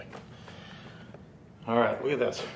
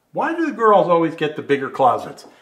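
mock outrage; 5 semitones higher, 6 dB louder than usual. Mock-indignant joke to the viewers.

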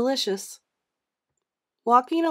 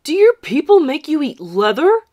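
mocking bully; 7 semitones higher, 11 dB louder than usual. Spider taunting John.

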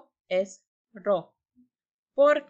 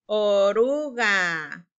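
"Oruga" drawn out slowly, a bit louder; slow read-along; 5 dB louder, much slower than usual. Shown as a demonstration. Teacher reads "Oruga" together with pupils, slowly. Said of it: "Lean conmigo".

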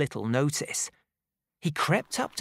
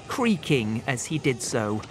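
usual speaking voice

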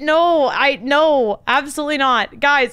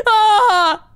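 scared scream; 8 semitones higher, 5 dB louder than usual. Real, not acted. Jump scare in game.

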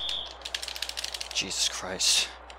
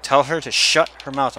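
ordinary talk

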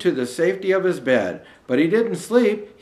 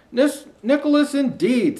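a hint of a grumpy old elf, pitched up; 9 semitones higher than usual. Grumpy elf scolding Alistair, lightly voiced scoff. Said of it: "said a grumpy old".

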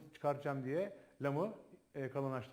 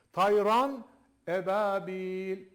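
slow chanted recitation; Quran recitation; 6 dB louder, much slower than usual. Shown as a demonstration. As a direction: recite the verse slowly, with tajweed. Recites words. (yavaş tecvidli tilavet)

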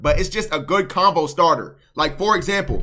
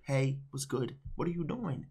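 soft casual greeting; 3 semitones lower, 15 dB quieter than usual. Shown as a demonstration. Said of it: "just be like, "What's good?".

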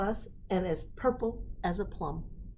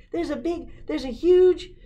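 excited blurting; 11 semitones higher, 12 dB louder than usual. Acted. Child blurting news to Dad.

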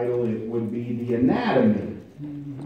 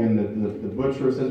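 normal talking voice

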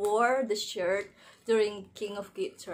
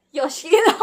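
excited reveal; 7 semitones higher, 12 dB louder than usual. Real, not acted. Announcing final card.

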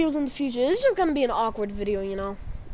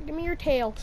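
ordinary talk

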